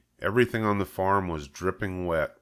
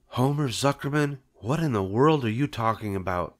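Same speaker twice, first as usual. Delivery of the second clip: higher-pitched, bewildered wife; 5 semitones higher than usual. A narrator's reading. Wife questioning her husband's odd news, bewildered. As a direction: question him in utter puzzlement. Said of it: "A look of complete bewilderment".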